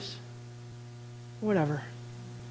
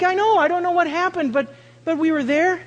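excited mom; 9 semitones higher, 5 dB louder than usual. Retold or imitated. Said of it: "She's like".

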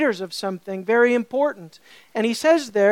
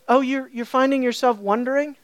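ordinary talk

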